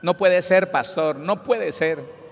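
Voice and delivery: ordinary talk, nothing marked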